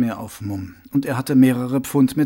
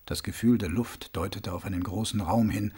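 plain reading voice

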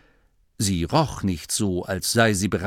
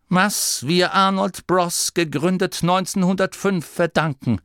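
firmly; 7 semitones higher than usual. Acted. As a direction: make the decision sound final. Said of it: "sagte er fest".

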